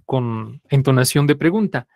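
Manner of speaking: normal talking voice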